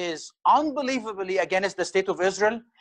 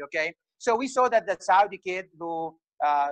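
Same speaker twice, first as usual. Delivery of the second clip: normal talking voice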